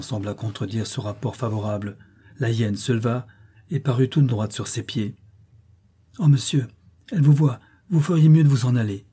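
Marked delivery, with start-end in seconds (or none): 6.2-9.1 higher, raised cry of alarm; exclaimed warning. A narrator's reading. Grace warns her master that she sees him, crying out. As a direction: urge the master away, crying out. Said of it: "s'écria Grace".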